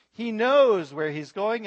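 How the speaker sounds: knows hit hard and loud; emphatic preacher's punch on knows; stress on knows. Real, not acted. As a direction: lean on the word knows. Preacher stresses Jesus' destination.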